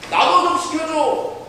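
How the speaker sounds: put-on high, slow pleading child voice; childish begging. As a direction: beg like a small boy wanting a part. Ralph begging to join play. (높고 느리게 조르는 아이 목소리)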